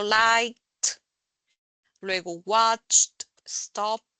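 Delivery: liked, watched, stopped with a crisp final T sound; ending like a T. Shown as a demonstration. Teacher showing the class the ed said as T. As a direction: clip the ed of liked into a T. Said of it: "es como una T".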